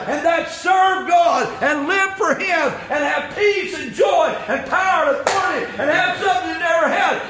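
high-pitched, driving pulpit shout; full-force preaching. Real, not acted. Preacher urging folks it's time to serve God, impassioned shouting. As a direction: exhort the congregation at full cry.

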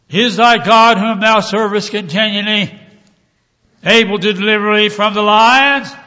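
high, loud anguished king; lamentable cry. Acted. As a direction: call down into the den, pleading loudly. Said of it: "cried with a lamentable voice".

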